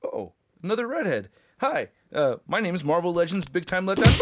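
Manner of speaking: swoopy cartoon toy voice; hammed-up Spider-Man toy, awkward introduction. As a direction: play a flustered toy hero greeting a redhead.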